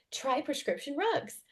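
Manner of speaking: ordinary talk, nothing marked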